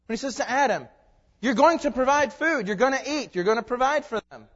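loud, high preacher's declaration; full-force preaching. Real, not acted. Preacher delivers God's words to Adam, loud and forceful. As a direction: proclaim it forcefully to the congregation.

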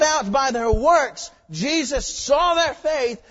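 slow, loud, high preaching build; full-force preaching. Acted. Pastor driving the point home to the church, loud slow preaching. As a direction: preach it out to the congregation, slowly and loud.

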